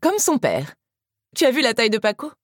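high, lively woman's dialogue voice; Teresa chiming in. Acted. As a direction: chime in teasingly, pitched well up. Voices Teresa teasing about the baby.

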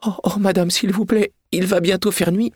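high, anxious begging; pleading. Acted. Frightened maid begging her mistress not to leave her alone, high pleading. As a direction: clutch her sleeve and beg.

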